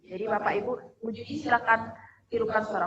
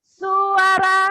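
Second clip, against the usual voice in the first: calling out; 8 semitones higher, 9 dB louder than usual. Real, not acted. Calling out animal sound turns.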